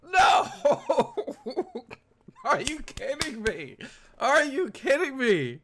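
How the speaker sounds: high, loud, repeated disbelieving shouts; stunned disbelief. Real, not acted. Viewer can't believe the plan, loud and disbelieving.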